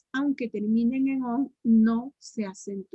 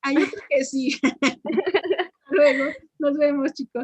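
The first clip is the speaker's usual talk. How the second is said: laughing, choked up; 3 semitones higher, 6 dB louder than usual. Real, not acted. Emotional goodbye to the class.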